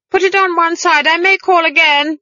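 high, loud call back over the shoulder; shouted. Acted. Granny shouting to the housekeeper as she runs off, high and loud. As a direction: shout it back as you run off. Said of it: "she shouted".

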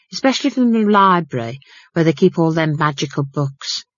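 natter on like a gossiping housekeeper; chatty housekeeper. Acted. Housekeeper chatters about servants.

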